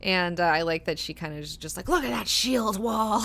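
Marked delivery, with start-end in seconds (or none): 1.9-3.2 slow, dazed wonder, raised pitch; a lightly played drugged daze; a high woman staring at visions, dazed; tripping. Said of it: "tripping the fuck out".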